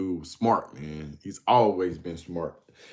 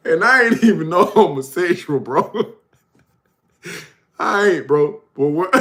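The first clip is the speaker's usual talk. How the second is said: ordinary talk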